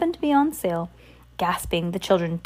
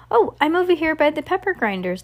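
higher-pitched answering call; calling back; 8 semitones higher than usual. A narrator's reading. Delivery person answers the shopkeeper, calling back. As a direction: call back lightly from another aisle. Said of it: "called out in return".

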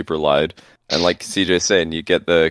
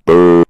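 imitated loud, droning "boo"; mock goal cheer as a loud monotone drone; 2 semitones lower, 9 dB louder than usual. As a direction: boom out one long toneless boo. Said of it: "like a loud monotone?".

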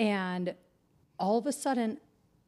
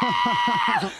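put-on very high, loud drunken howl; wild mock war cry; imitated scream; 6 dB louder than usual. Drunk boyfriend storming up the stairs, imitated screaming howl. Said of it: "he comes screaming up the thing".

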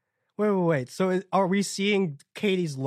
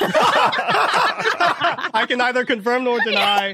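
laughing coyly; 5 semitones higher, 6 dB louder, much slower than usual. Real, not acted. Teased about cheating.

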